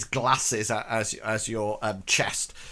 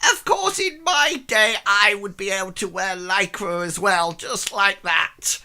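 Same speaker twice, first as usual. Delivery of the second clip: put-on high old lady voice, slowing at the end; old woman; 8 semitones higher, 5 dB louder than usual. Old woman boasting about wearing lycra in her day. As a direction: reminisce proudly like a vain old lady.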